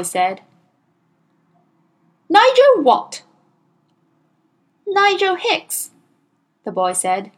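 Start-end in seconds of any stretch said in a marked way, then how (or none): 2.3-3.2 very high, loud bellow. A narrator's reading. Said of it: "the Trunchbull bellowed". 4.9-5.9 put-on high, slow child's reply; small boy. A narrator's reading. Boy answers again.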